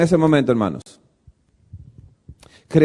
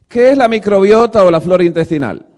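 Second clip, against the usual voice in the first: normal talking voice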